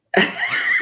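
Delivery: short high-pitched giggle; high laugh. Real, not acted. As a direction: giggle at the punchline. Laughs at story punchline.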